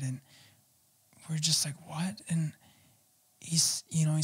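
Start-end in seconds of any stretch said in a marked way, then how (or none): 1.9-2.2 a breathless, whispered what; little boy whispering in shock to a stranger; stunned whisper. Retold or imitated. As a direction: barely voice it, caught off guard. Shocked boys hear "I'm your dad.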